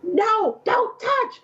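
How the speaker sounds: high-pitched mock protest; retold frantic warning. Mother as baby starts wiggling, frantic. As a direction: re-enact an alarmed order, voice way up.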